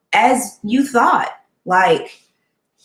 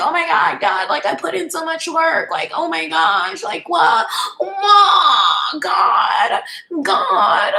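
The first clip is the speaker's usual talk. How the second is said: higher, tearful mock cry to God; mock anguished plea; 7 semitones higher than usual. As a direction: wail it like you're begging God why. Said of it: "sometimes I'm like".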